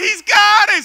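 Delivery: high, very loud mock pastor yell; triumphant pastor. Pastor telling the church he's got it, triumphant yell. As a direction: announce it as a hammed-up victory shout. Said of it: "The pastor's like".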